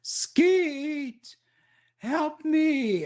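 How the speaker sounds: high-pitched cry without full volume; drawn-out, high acted scream; mock scream for help. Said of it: "screams at the top of her voice".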